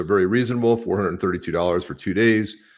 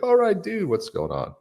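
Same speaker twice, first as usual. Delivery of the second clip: high-pitched, breezy "what's up" to a fan; playful pretend welcome for a fan; mock casual greeting; 13 semitones higher than usual. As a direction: greet him like an old buddy. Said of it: "We'll be like".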